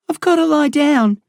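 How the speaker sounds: high, fading plea; weak frightened voice; exhausted scared boy. A narrator's reading.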